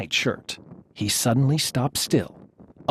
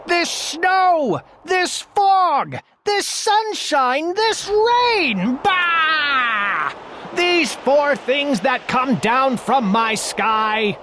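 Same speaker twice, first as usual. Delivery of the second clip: high, slow, furious royal shout; old king yelling high and slow at the weather; 9 semitones higher, much slower than usual. A narrator's reading. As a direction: bellow at the sky like an outraged king. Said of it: "he started shouting".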